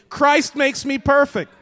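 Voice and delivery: high, loud, forceful declaration; like you mean it. Shown as a demonstration. Preacher rousing the congregation to say it like they mean it, loud and emphatic. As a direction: declare it loudly with full conviction. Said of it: "Let's say it like you mean it".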